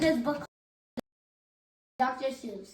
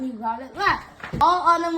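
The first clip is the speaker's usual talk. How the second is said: plain reading voice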